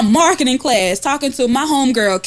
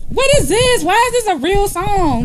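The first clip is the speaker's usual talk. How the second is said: outraged; 9 semitones higher than usual. Retold or imitated. Outraged at song remake.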